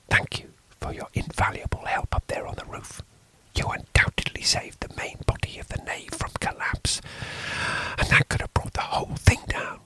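quick, breathless whisper; hushed whisper. A narrator's reading. Stranger whispering to the priest in the confessional. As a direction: call it off under your breath.

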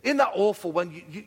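normal talking voice

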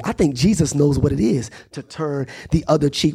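normal talking voice

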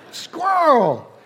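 high mock alarm cry; hammed-up, drawn-out warning shout; wife's startled shout. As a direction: yell it like a panicked passenger. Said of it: "But she'll shout".

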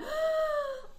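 sharp, high collective gasp imitation; shocked audience gasp. Imitating the audience as Rachel checks his messages, shocked gasp. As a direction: gasp like a stunned studio audience. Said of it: "everyone's like".

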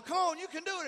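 put-on high, loud coaxing call to a child on the diving board; encouraging parent. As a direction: cheer the kid on from the pool. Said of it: "they're like going".

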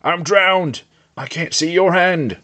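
high, panicked cry; acted high-pitched distress; drowning panic. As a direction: wail it out as you go under. Sam drowning, crying for help.

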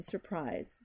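usual speaking voice

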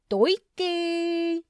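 high, loud goofy yelp; goofy shout. Acted. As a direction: blurt it like a dopey bro. Chet's goofy exclamation.

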